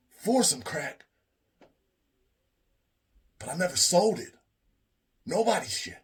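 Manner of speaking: high, loud, whiny denial; indignant Chip. Retold or imitated. Chip denying he's a crackhead, high and indignant. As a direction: protest hard, deeply offended.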